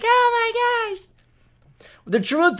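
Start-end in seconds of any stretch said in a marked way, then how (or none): 0.0-1.1 very high mock British "Oh, my gosh"; a high, silly fake British exclamation; mock accent gasp. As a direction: squeal it like a hammed-up posh Brit. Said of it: "And you goes like".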